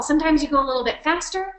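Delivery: quick, lively run of words. Shown as a demonstration. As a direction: pick up the pace briskly. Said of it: "working to demonstrate this".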